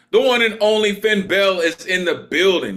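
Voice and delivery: high, loud MC name reveal; announcer introduction. Real, not acted. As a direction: proclaim the star's arrival to the crowd. Host announces the performer's name.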